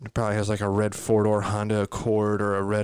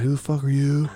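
louder, higher retort; challenging comeback; 4 semitones higher, 5 dB louder than usual. Retold or imitated. New owner firing back at the dog, loud challenge. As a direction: throw the question right back. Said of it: "I'm like".

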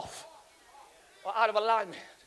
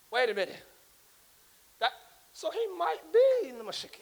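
ordinary talk

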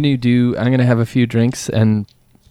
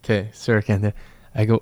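ordinary delivery

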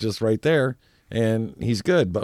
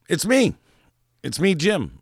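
playful friend; 8 semitones higher than usual. Retold or imitated. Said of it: "he's like".

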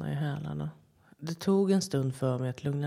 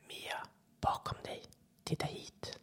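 soft, toneless, distant whisper; eerie whisper; 8 dB quieter than usual. A narrator's reading. The thing in the rocks luring Mia closer, eerie whisper. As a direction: coax her from the dark.

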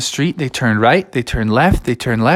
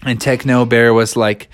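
usual speaking voice

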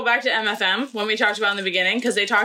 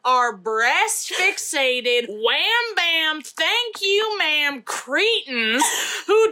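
put-on Southern accent; 2 semitones higher than usual. Co-host playing outspoken Texan activist in interview, thick Southern accent. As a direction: lay on a thick Texas accent with big pitch swings. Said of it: "give me your best Southern accent".

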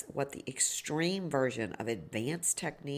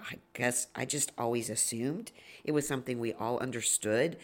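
usual speaking voice

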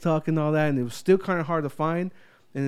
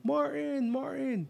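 calling out; 11 semitones higher, 5 dB quieter, slower than usual. Retold or imitated.

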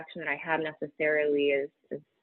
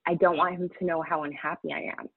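ordinary talk

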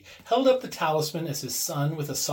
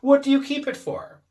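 1 pressing question; 12 semitones higher, 7 dB louder than usual. A narrator's reading. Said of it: "said the old man at last".

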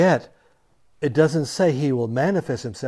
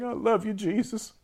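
mock slobbering plea; 8 semitones higher, 5 dB quieter than usual. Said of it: "crying and slobbering and saying".

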